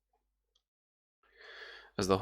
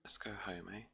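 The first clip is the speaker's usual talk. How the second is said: soft motherly plea; 2 semitones higher, 12 dB quieter, faster than usual. Acted. GM voices the mother.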